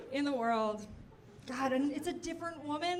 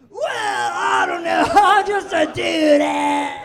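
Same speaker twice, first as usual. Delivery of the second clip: very loud, slow caricature dude voice; badly faked impression, drawn-out dude shout; Obama as dude; 5 semitones higher, 15 dB louder, slower than usual. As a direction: bellow it out, dragging every word. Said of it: "And Obama's like".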